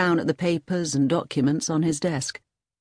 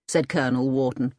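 plain reading voice

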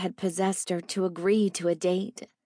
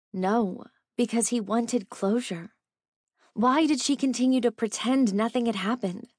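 usual speaking voice

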